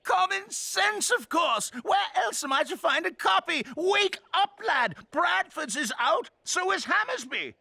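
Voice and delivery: high, impatient customer; agitated demand. Acted. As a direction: demand it as if it's obvious.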